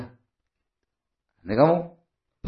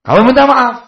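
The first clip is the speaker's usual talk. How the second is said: ordinary talk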